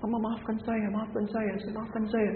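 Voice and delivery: put-on high, soft tearful child voice; a touch of a weeping child pleading; child crying apology. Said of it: "anaknya langsung bangun menangis".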